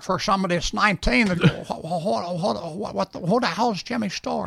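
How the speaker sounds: high, stammering old film star impression; Jimmy Stewart. Shown as a demonstration. Impressionist demonstrates for nineteen-year-olds, high stammering star voice. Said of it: "if you're doing Jimmy Stewart".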